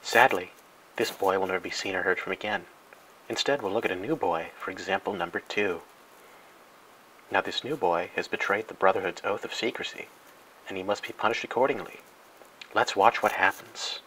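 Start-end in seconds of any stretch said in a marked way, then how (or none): none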